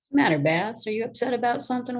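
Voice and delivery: high, fast pet-talk to the cat; sing-song question to a pet. Real, not acted. Talking to her cat Bass.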